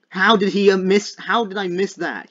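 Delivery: high, loud outburst at a miss; exasperated disbelief. Real, not acted. Player who just missed the target, exasperated outcry.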